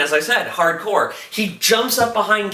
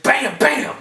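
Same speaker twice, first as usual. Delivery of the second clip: high-pitched vocal gun blasts; imitated gunshots; 6 semitones higher than usual. Satrakian still firing at Eichhorst, imitated gunshots. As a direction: punch out shot noises, voice raised. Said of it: "still just like".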